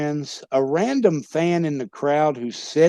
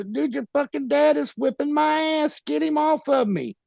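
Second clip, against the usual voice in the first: much higher, half-laughing mock panic; frantic plea; 10 semitones higher than usual. Wrestler begging partner to pull his dad off, high mock panic. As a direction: plead for help, voice jumped way up. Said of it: "I go".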